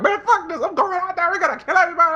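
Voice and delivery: high-pitched, frantic mimicry; squealed mock battle hype; mock reckless teammate. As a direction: imitate a gung-ho friend charging off, high-voiced. Mimics Alex ignoring the plan.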